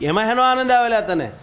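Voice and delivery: a hint of a scolding old woman; mocking old woman. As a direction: jeer at him like a village granny. Old women mocking the king.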